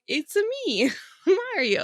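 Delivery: high, laughing little Mario impression; a quick goofy Mario imitation; mock Mario voice. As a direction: squeak out Mario's name through a laugh. Mimics Mario voice.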